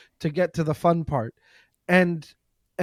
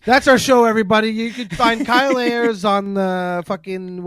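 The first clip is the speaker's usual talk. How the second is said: show sign-off; 5 semitones higher, 5 dB louder than usual. Real, not acted. Podcast sign-off to listeners.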